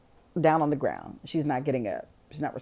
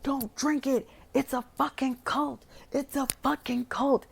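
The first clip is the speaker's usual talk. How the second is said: high, urgent mock yell; frantic retold warning, pitched way up; imitating crazed panic; 8 semitones higher than usual. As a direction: act out someone going crazy, high and frantic. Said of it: "Will goes fucking crazy".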